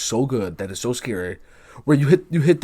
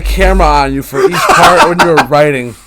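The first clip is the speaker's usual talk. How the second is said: loud, higher and eager; excited gushing; 4 semitones higher, 13 dB louder than usual. Real, not acted. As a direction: gush at the author with enthusiasm.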